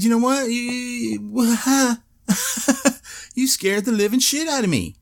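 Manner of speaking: high, half-laughing complaint; exasperated. Retold or imitated. Patient scolding the doctor, high and exasperated. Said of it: "I said".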